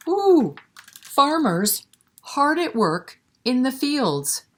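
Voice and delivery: high, marvelling ooh then bright reading; wondering ooh. A narrator's reading. Librarian reading to kids, marvelling at fields. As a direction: sound amazed at the view.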